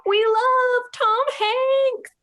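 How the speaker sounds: slow, high, loud fan cheer. Pretend. Fans cheering for Tom Hanks, loud and drawn out. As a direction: belt it out like a crowd of fans.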